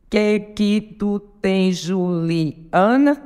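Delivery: very slow, syllable by syllable; contando sílabas. Shown as a demonstration. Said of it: "conte comigo". (escandindo devagar, sílaba por sílaba)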